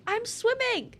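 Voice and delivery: high-pitched, pleading whine; exasperated protest. Real, not acted. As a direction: protest desperately to the game. Game will not respond.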